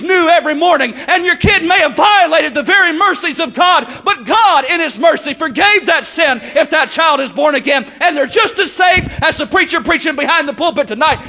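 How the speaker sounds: loud, high shouted preaching to the congregation; full-force preaching. Acted. Preacher to congregation about God's mercy, full shouted preaching. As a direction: preach it out with all your might over the crowd.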